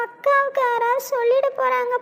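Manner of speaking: ordinary delivery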